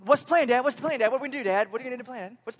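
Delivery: a hint of an impatient child; imitated pestering son. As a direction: pester Dad with excited questions. Son pestering dad after grace.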